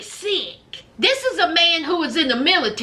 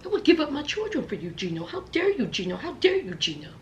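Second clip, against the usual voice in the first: soft, low offended wife; quiet indignant; 4 semitones lower, 8 dB quieter, much faster than usual. Retold or imitated. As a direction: murmur it, offended and wounded.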